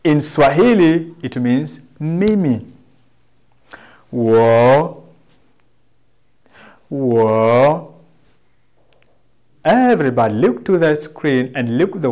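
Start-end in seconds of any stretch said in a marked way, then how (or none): 4.1-8.0 "Wo" stretched, low then rising; model pronunciation. Shown as a demonstration. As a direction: demonstrate a long dipping "Wo". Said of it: "try to pronounce the way I do".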